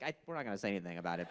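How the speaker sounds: low, quick undertone; hushed, hurried politeness; polite aside. Retold or imitated. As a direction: murmur it discreetly, fast and soft. Voices the polite, silent crowd.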